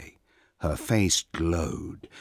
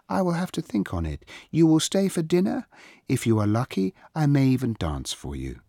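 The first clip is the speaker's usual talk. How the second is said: high-pitched, regal princess voice; haughty princess; 9 semitones higher, 4 dB louder, faster than usual. A narrator's reading. Princess invites a stranger to dinner, haughty. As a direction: grant the favor from on high.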